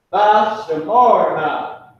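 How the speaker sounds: "tha shamarna" drawn out slowly and loud; slow Hebrew phrase. Shown as a demonstration. Teacher modelling the Hebrew phrase for students, slow and loud. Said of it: "This is what you would say".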